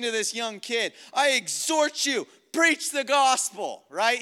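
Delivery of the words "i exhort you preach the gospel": high, stretched-out commanding charge; impassioned fatherly command; church father exhorting. Retold or imitated. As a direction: fire up a young protégé. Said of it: "like the father of the Mediterranean church speaking".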